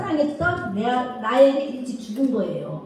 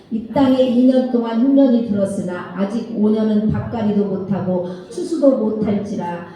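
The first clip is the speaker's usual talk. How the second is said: steady, louder Bible reading aloud; scripture reading; 2 semitones lower, 4 dB louder than usual. Acted. Teacher reading Genesis aloud to the children, steady scripture reading. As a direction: read the passage out for the class to follow. Class reads Genesis together.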